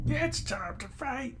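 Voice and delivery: high, slow battle cry; hammed-up mayor ready to fight; defiant old mayor. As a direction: draw out the challenge in a strained high voice.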